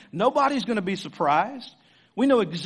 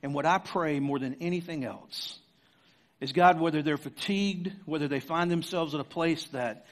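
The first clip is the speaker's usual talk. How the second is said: prayer; 4 semitones lower than usual. Real, not acted. Closing prayer.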